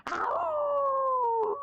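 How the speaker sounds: squeaky high wolf howl; high yelped howl. Acted. Fake wolf trying to howl, high squeaky yelp. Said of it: "my ow could be a lot better".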